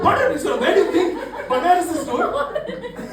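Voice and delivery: loud, high-pitched exasperation; hammed-up offended protest; indignant retort. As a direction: fire back in comic disbelief. Acted indignant retort to border question.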